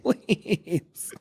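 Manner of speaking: small, high, soft mock plea; hammed-up whimpering plea; playful pleading. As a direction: beg in a tiny high voice. Playfully begging to stay.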